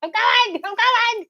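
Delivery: high, loud comic henchman voice; answering yell. Acted. Henchman answering the general's call, high comic yell. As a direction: shout back in a squeaky pitch.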